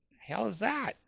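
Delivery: high-pitched, quiet bafflement; baffled. Retold or imitated. Man questioning his inner advisor about jargon, baffled. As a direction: ask it high and under your breath, mystified.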